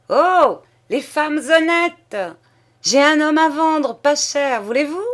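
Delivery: high, loud mocking hail across the water; shouted call. Rower calling to the ladies on the bank, loud high teasing shout. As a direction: yell it out from the boat, teasing. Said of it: "Une d'elles cria vers la berge".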